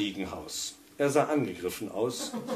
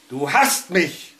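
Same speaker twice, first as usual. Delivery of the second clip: louder, slow accusing cry; anguished cry; 7 semitones higher, 10 dB louder, slower than usual. A narrator's reading. Tormented husband cries out at his radio, anguished. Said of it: "rief der vielgeprüfte Mann".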